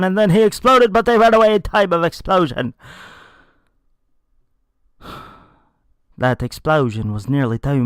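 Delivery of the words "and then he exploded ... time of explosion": much higher and louder, rushing; excited climax. A narrator's reading. Narrating Zaius exploding as everyone flees, excited rush. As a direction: let the explosion burst out of the telling.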